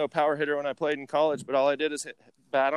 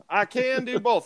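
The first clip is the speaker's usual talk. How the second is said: higher-pitched put-on Southern accent; 6 semitones higher, slower than usual. Shown as a demonstration. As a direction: show off a playful Southern twang. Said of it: "Southern United States or Southern Prineville".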